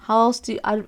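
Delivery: ordinary delivery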